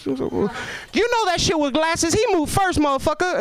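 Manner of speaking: very high, sassy mock woman's voice; hammed-up lady calling him out. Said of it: "and she knows".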